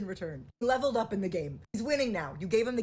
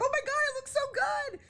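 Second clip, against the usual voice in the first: very high, gushing squeal of delight; excited squeal; 4 dB louder than usual. Retold or imitated. Wife seeing the vanity her husband built, excited squeal. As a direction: squeal it like you're jumping up and down. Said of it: "I just started jumping up and down".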